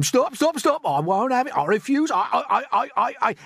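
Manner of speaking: high, loud, stammering refusal; panicked cry. A narrator's reading. Earthworm refusing to be bait, panicked crying out. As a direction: protest loudly until you are stuttering. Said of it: "cried the earthworm".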